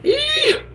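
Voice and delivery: sudden delighted shriek, very high but soft; a tiny excited eee; high squeal. Real, not acted. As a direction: squeal at the floppy ears. Reacting to floppy-eared filter.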